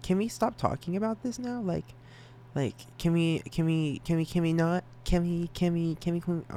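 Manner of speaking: high whiny cringing plea. Pretend. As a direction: beg them to stop, squirming. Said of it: "I will audibly just sit there and be like".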